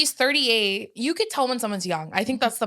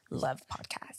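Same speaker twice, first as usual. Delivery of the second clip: whisper; 7 semitones lower, 12 dB quieter, slower than usual. Real, not acted. Playful whispered aside.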